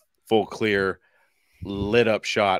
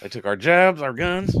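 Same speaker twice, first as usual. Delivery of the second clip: put-on corny Southern accent; 6 semitones higher, 3 dB louder than usual. Host does the corny Southern accent for his cohost. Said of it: "Do the corny accent".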